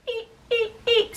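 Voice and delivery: high little "eep"; small squeak. Retold or imitated. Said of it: "I was like".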